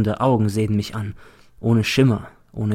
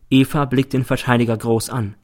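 plain reading voice

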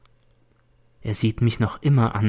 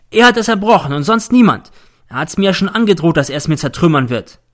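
higher, louder, faster accusation; angry accusation; 6 semitones higher, 6 dB louder than usual. A narrator's reading. Z accusing N before the teacher, angry. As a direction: rant the charge out.

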